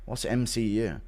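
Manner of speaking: ordinary delivery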